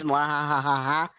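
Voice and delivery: slow evil villain cackle; mad scientist laugh. Pretend. Evil laugh after D&D idea.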